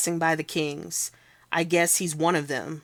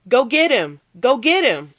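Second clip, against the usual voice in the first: higher, louder urging yell; excited shout; 7 semitones higher, 8 dB louder than usual. Acted. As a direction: egg them on at full voice. Bystander urging chase.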